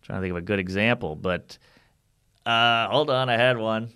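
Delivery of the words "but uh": ordinary talk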